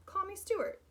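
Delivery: high, eager insistence; boy pleading with the bird to drop the sir; eager cry. Acted. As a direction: plead to be called by name. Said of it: "cried Stuart".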